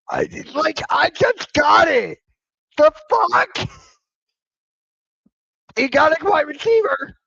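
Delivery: real shock that Buffalo got a receiver; stunned outburst. Real, not acted. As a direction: blurt it out in shock. Stunned reaction to trade.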